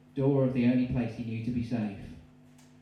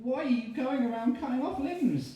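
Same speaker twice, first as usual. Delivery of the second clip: freaked-out shriek; 12 semitones higher, 3 dB louder than usual. Acted. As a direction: back away screaming at the freak. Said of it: "turned again to Tommy and said".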